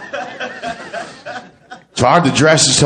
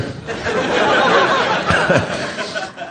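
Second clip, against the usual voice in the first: airy laugh; 5 dB quieter than usual. Real, not acted. Comic laughs at himself.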